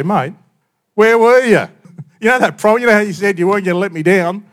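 high, loud mock telling-off; reproachful mate. Imagined Jesus scolding Peter, a loud mock reproach. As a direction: play the let-down friend scolding. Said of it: "it would have been tempting to say".

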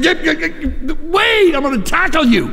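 high, hurried, alarmed shouting to stop him; urgent buddy. Retold or imitated. Roy's buddy trying to stop his wrong-way run, urgently. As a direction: chase him down in mock panic. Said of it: "along came his buddy saying".

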